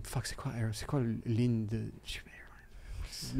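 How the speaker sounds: very quiet, slow mutter fading to a whisper; inner thought. Retold or imitated. Comedian privately hunting for a forgotten word, whispered inner panic. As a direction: think it under your breath, searching for the word. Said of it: "je suis comme, je pense".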